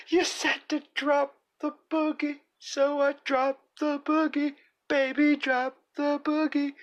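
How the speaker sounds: very high squeaky character; squeaky cartoon voice. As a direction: play a squeaky little character.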